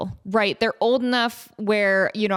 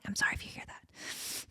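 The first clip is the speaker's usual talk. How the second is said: hushed unvoiced aside; a soft whispered confession; 15 dB quieter than usual. Real, not acted. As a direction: whisper it as if embarrassed. Apologizing for stomach noise.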